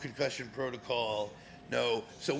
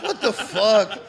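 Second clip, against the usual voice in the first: loud, high laughing what-the; cracking up in mock shock; laughing outburst; laughing disbelief; 9 semitones higher, 15 dB louder, slower than usual.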